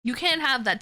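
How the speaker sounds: ordinary talk, nothing marked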